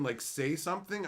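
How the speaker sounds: ordinary delivery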